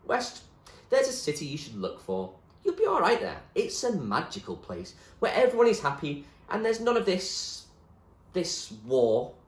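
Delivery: William, dreamy. Acted. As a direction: paint the magic city. William describes magical city.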